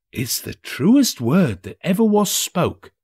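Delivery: smug agreement from the laundress; a touch of a gossiping old woman; agreeing laundress. A narrator's reading. Mrs Dilber agrees.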